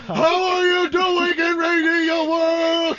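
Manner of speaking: loud, slow, booming greeting; booming shout. Real, not acted. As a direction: call it out big and slow. Greeting new mic loudly.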